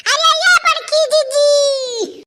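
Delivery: hammed-up shout for help, voice pushed up; calling for help. Panicking sister calls the village for help, hammed-up shout.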